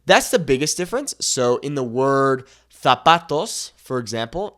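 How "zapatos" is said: "zapatos" with a TH sound for the Z; Spanish from Spain; Castilian accent. Shown as a demonstration. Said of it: "in Spain, we would use that".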